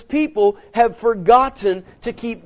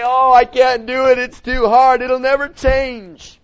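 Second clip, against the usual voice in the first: whining complaint; 3 semitones higher, 6 dB louder than usual. Retold or imitated. Mimics discouraged believer's complaint.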